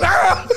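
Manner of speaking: high imitated yelp of fright; startled yelp. Imitating the roommate's fright at the door. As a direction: squeal like you just got scared again. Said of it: "Und er".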